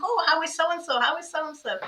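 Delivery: high, bright-eyed eager asking, a lightly voiced elderly mother; imitating mum's eager questions. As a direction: play the confused mum, delighted to hear news.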